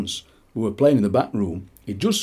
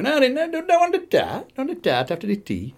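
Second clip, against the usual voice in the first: high, mock polite no thank you; squeaky pretend protest; mock polite refusal. Refusing Uncle Jack's tarts on Boxing Day, high mock protest. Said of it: "So you go".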